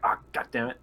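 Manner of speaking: ordinary delivery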